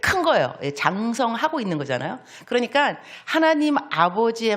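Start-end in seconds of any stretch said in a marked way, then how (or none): none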